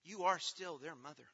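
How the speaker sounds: ordinary delivery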